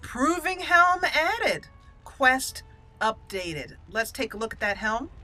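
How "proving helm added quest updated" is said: slow, drawn-out notification read-out; mock announcer. Player announcing her new item and quest, grandly drawn out. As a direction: stretch it out like a game announcer. Reads game notice in announcer style.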